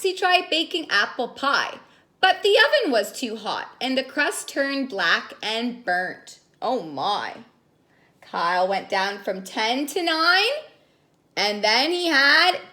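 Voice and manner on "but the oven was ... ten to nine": ordinary delivery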